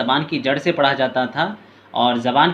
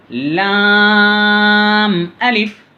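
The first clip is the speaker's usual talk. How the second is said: slow, high, louder chanted lam alif; drawn-out recitation; 5 semitones higher, 6 dB louder, much slower than usual. Shown as a demonstration. Teacher demonstrates the lesson's letters to pupils, slow chanted and louder. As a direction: hold लाम very long, then a falling अलिफ. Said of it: "अक्सर लोग हैं इसको अलिफ पढ़ते".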